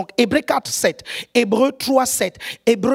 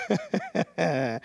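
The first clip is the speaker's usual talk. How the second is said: soft low rolling chuckle; 8 semitones lower, 11 dB quieter than usual. Real, not acted. Preacher amused about couples, low soft chuckle.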